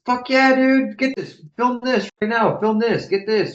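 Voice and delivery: high-pitched, excited urging; imitating hyped artists. Rappers telling the blogger to film them, excited and high. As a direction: act out a hyped-up crew demanding the camera. Retold artists eager to be filmed.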